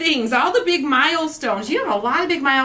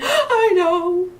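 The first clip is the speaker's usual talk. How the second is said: mock sob of mixed feelings; emotional wail; 8 semitones higher, slower than usual. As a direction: mom acting out her own teary reply to her husband. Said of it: "I'm just really emotional".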